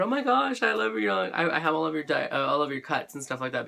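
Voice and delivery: high, excited gushing; excited fangirl. Retold or imitated. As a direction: gush like a starstruck fan. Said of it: "I told her".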